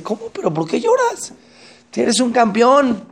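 very high, astonished question, then slow praise; wife baffled by her husband's tears; puzzled wife. Acted. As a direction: she can't believe he's crying.

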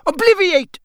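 sharp, high, loud spell cast. A narrator's reading. Wizard casting a spell on Mister Roberts, sharply. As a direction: fire the command out high and loud. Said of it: "he said sharply".

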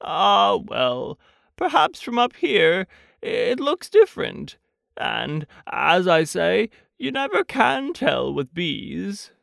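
high, slow, wistful Pooh; musing storybook bear. Acted. Pooh consoles himself up in the air, slow musing. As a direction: muse aloud, stretching the last phrase.

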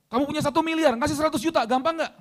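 high, loud quiz shout; loud challenge. Pretend. Preacher challenges congregation with bigger sums, high loud challenge.

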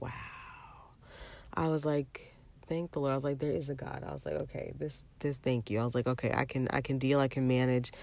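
soft, hushed words of thanks; hushed, relieved self-talk; quiet relief. Retold or imitated. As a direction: breathe out the relief under your breath. Relief after power returns alone.

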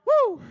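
high, loud excited "woo" cry; loud whoop. Real, not acted. Preacher exclaims after point lands.

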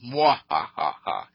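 slow mock villain cackle; hammed-up slow evil cackle; evil laugh. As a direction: drag out a cartoon evil laugh. Villain gloats after turning evil.

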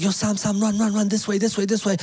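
high urgent calling out; calling Sam to run this way; friends calling out. Retold or imitated. Friends yelling to their buddy to run, urgent call. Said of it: "They're like".

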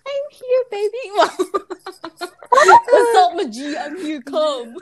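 lightly voiced luring mermaid; siren voice. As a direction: coo like a siren calling sailors. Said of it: "call him with your siren voice".

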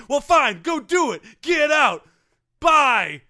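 loud, high-pitched mock dismissal; angry boss. Boss sending the fired coach away, loud and fed up.

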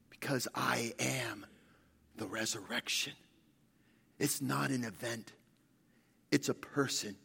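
low, soft sermon close with pauses; hushed preaching. Real, not acted. Pastor closing the message before calling up a colleague, hushed preaching. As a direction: bring the message down to a quiet landing.

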